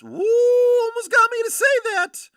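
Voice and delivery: high, loud laughing yelp; excited yelp. Real, not acted. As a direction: yelp at the near slip. Nearly said a rude word.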